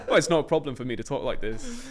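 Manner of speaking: put-on Brummie accent, a bit posh. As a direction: talk in a Birmingham accent. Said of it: "Did you try and do a Brummie accent".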